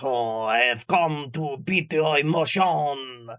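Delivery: slow, mopey French accent, lightly put on; mock sad Frenchman. Imagining the depression Frenchman, in a slow mock French accent. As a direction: drag it out like a gloomy Frenchman with a baguette. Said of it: "is it some sad Frenchman like".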